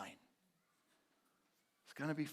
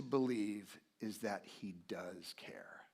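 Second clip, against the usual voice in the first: slow hushed whisper; soft, slow whispered promise; 4 semitones lower, 6 dB quieter, slower than usual. Real, not acted. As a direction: whisper the assurance slowly. Sermon assurance, whispered.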